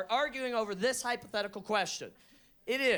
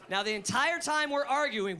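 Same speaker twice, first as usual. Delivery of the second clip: normal talking voice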